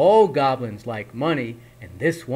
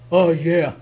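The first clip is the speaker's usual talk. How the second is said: grumbling; 3 semitones higher, 7 dB louder than usual. Acted. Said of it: "the goblin grumbled".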